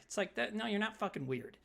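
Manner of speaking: ordinary talk, nothing marked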